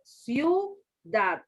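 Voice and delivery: CIUDAD in two slow syllables, ciu... dad; slow CIUDAD divided only after the glide; correct syllable split. Shown as a demonstration. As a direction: hold CIU as one syllable, pause, then DAD. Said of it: "debe de poner".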